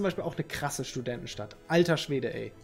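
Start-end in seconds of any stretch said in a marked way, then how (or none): none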